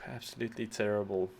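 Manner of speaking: ordinary talk, nothing marked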